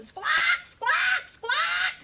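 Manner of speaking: high, loud bird squawks; shrill bird screech. A narrator's reading. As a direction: screech like greedy birds. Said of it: "screeching and squawking".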